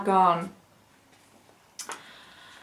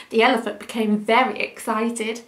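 usual speaking voice

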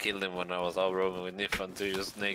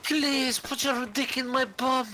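very high squeak; squeaky voice; 4 dB louder than usual. Pretend. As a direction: squeak it falsetto. Crude joke in squeaky voice.

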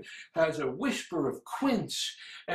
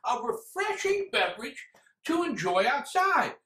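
high, questioning echo of the sales pitch; incredulous repeat; 6 semitones higher than usual. Acted. Reviewer mocking how the drink is sold, incredulous. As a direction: throw the slogan back in disbelief.

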